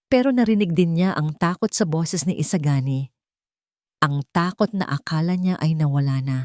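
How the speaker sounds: ordinary delivery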